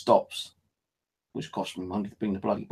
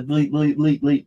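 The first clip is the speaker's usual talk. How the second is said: high sing-song bleep, bleep imitation; phone bleeps; 5 semitones higher, 6 dB louder than usual. Annoyed at phone bleeps, imitating them sing-song. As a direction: mimic the phone's notification bleeps.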